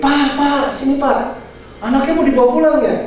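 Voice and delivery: high, eager doctor calling out from afar. Retold or imitated. Doctor calling the father over from across the ward, high and eager. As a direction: call him over like the doctor did. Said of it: "Dokter dari jauh gini".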